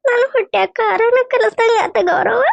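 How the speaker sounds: high-pitched tearful mother's lament; crying. Acted. Mother weeping to daughter-in-law over her unfed son, crying. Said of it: "ಎದಕ್ ಅಳ್ತಿ ಬೇ".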